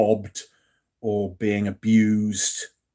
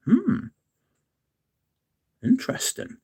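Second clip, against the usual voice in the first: intrigued hmm. Real, not acted. Sniffing rose concrete.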